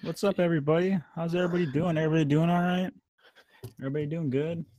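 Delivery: high, bright, energetic greeting; hyped podcast host. Acted. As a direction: pump up the crowd. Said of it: "I hate myself".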